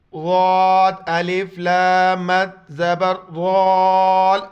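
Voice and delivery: loud, drawn-out teacher spelling; spelling drill. Shown as a demonstration. Teacher to students, loud spelling drill. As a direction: call each letter out to the class. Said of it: "اس کو اجا کرنے کا طریقہ یہ ہے".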